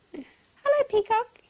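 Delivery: high, loud chick greeting; squeaky-high storybook chick; little chick. A narrator's reading. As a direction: pipe up cheerily to a bigger bird. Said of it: "he said".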